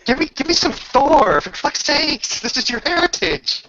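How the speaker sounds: high, loud rallying cry; rallying shout. Real, not acted. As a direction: fire her up to call the lightning. GM urging Thor action.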